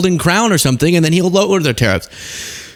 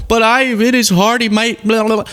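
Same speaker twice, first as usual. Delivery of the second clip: higher, dismissive mock whine; mocking defender; 7 semitones higher than usual. Host mocking defenders of the president, dismissively. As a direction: brush them off in a silly whine. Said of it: "Don't do this whole".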